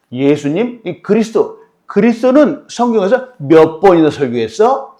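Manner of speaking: high, loud sneering question; sarcastic taunt. Retold or imitated. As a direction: needle the new believer. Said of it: "이 사람 비꼬면서 얘기하는 거예요".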